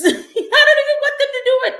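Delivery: laughing it out, high and loud; half-laughing in disbelief. Real, not acted. Laughing in disbelief at sending kids to war.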